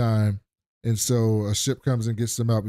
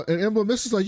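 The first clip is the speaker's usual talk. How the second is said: ordinary talk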